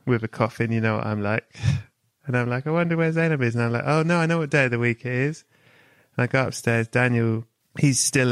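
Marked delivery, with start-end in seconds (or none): 2.7-3.6 mock curious self. Host voices himself in story. 3.8-5.4 mock realization. Host voices himself in story.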